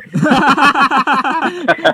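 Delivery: high loud burst of laughter; laughing. Real, not acted. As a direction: laugh at your own jab. Mock grudge, big laugh.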